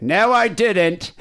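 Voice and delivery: high, loud, drawn-out protest; dad's denial. Retold or imitated. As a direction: call out a stretched, indignant denial. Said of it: "I could hear my dad be like".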